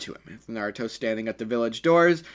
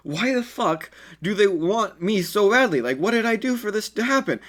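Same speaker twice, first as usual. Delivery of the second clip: exasperated disbelief; 8 semitones higher than usual. A narrator's reading. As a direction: plead to the sky in disbelief. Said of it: "so confused by this".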